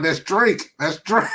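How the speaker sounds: high, mocking comedian impression; imitating Chappelle. Fan retelling a Chappelle joke, high mock voice. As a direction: say it high and playful like a stand-up bit. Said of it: "he's like, he's like".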